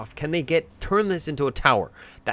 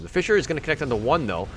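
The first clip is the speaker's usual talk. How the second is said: louder, higher, excited fight call; live play-by-play; 6 semitones higher, 7 dB louder than usual. Acted. Caster calling the teamfight to viewers, excited play-by-play. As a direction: ride the teamfight as it unfolds.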